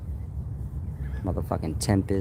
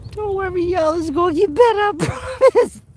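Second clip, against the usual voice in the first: laughing; 4 dB louder than usual. Real, not acted. Repeat, still laughing.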